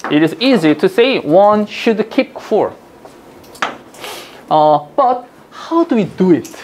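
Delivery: very slow, deliberate English reading; passage read-aloud. Shown as a demonstration. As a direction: read the English out phrase by phrase, very slowly. (또박또박 천천히 영어 지문 낭독)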